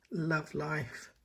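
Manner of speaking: usual speaking voice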